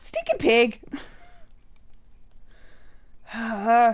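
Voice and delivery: usual speaking voice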